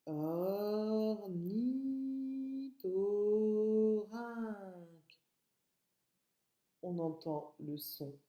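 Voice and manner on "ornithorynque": Ornithorynque extremely slow, each syllable held; "Ornithorynque" as four long-drawn syllables; slow syllable breakdown. Shown as a demonstration. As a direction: pull "Ornithorynque" right out, vowel by vowel.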